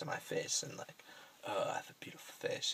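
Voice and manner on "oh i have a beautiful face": whispered, a touch of mock vanity; mock vain whisper. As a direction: breathe it out like a vain secret thought. Imagined vain inner thought.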